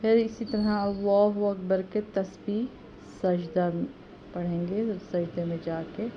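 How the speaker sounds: ordinary talk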